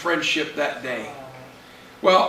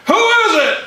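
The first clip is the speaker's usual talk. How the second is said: very high, loud call; hollered question; 13 semitones higher, 6 dB louder than usual. Acted. Friend calls from inside the house, hollering. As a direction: shout it through a closed door.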